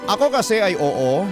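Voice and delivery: ordinary talk, nothing marked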